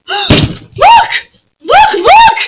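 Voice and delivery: high, loud repeated calls; excited cry. Acted. Lucy calling to the others on seeing the lion, high excited cries. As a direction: cry out in wonder, pointing. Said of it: "cried Lucy".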